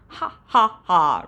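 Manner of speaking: the dragon roars with laughter, read-aloud; roaring laugh. A narrator's reading. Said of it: "roared the dragon".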